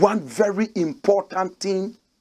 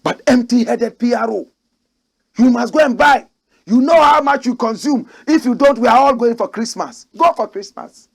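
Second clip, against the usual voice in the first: angry rant; 4 semitones higher, 6 dB louder than usual. Real, not acted. Ranting at the PRO.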